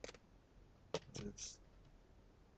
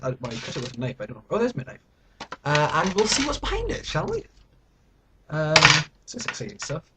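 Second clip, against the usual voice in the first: normal talking voice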